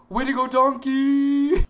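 high, slow mock cheer of donkey; cheering Raven. Raven points at Sam and cheers, high slow mock cheer. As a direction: shout it proudly like a fan. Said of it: "she points to him and goes".

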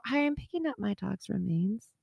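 small, quiet retold request; really quiet. Owner collecting her dog's remains at the vet desk, really quiet. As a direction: say it softly, holding it in. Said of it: "I got really quiet".

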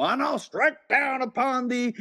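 imitating Jules's slow menace; quoting Jules. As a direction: intone it like the scripture scene. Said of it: "says it again in a completely different way".

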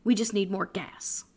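understated mock-politician payoff; deadpan punchline. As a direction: drop it quietly after the big pleading.

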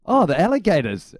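high, bright mock recognition; mock enthusiastic reply. To Kevin Rudd, pretending to know the team. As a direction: act impressed by the name.

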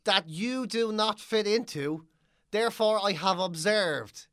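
slow, carefully spelled-out paraphrase; measured, deliberate wording; slow deliberate rephrasing. Retold or imitated. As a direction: lay it out word by word. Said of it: "I'm thinking you saying".

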